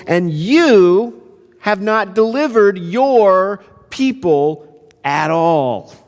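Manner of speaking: high-pitched acted reproach; indignant accusation. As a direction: protest to God, slowing heavily at the end. Moses accuses God, acted.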